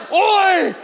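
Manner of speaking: high mock-Scottish answering shout; butchered Scottish cry. Congregation answering the preacher, butchered Scottish battle cry. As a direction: shout back like a Scottish warrior. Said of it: "And you're like".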